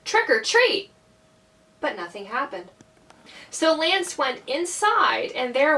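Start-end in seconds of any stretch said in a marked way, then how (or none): 0.1-0.9 higher, firmer call; a little louder. A narrator's reading. Lance gets no answer, tries a little louder. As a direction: raise it a notch, pitched up high. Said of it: "He said a little louder".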